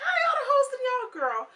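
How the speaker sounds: high sassy disbelief; mock disbelief. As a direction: squeal it in incredulous shock. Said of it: "I'm like".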